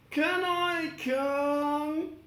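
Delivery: high, slow, hopeful question; Tin Man asking. Acted. The Tin Man asking Dorothy to join, high and slow. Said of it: "asked the Tin Man".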